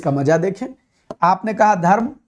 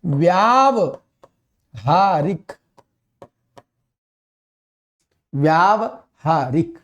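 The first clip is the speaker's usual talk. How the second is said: very slow, each syllable stretched; teacher stretching व्यावहारिक for the class; slow spelled answer; 2 semitones lower, much slower than usual. Shown as a demonstration. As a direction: draw out व्यावहारिक with a long व्या. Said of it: "या पर बढ़त मात्रा".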